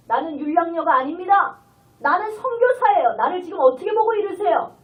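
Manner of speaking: loud, indignant-style acted rebuke; imagined bold retort, forcefully declared; bold protest. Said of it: "그렇게 담대하게 얘기하고 싶으나".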